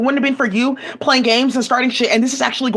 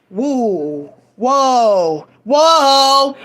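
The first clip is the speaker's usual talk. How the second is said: whoa, each one louder; growing amazement; 4 dB louder, much slower than usual. Real, not acted. Reacting to the screenshots on screen, rising astonishment. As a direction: build from a murmur to a loud exclaim.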